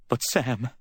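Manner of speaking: high, mild wail. A narrator's reading. Distraught woman protesting to Sam, wailing. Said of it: "she wailed".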